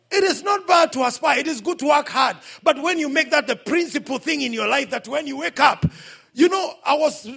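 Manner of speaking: loud, high preaching climax; preacher raising his voice over the crowd; fiery preaching. Real, not acted. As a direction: drive it home to the congregation. Preaching at full force.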